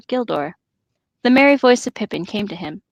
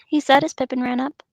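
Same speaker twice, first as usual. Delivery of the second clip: plain reading voice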